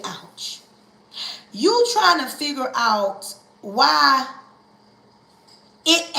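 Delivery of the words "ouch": soft unvoiced ouch, barely breathed; a hushed whispered ouch. Shown as a demonstration. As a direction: whisper it like a small sting. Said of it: "Just say".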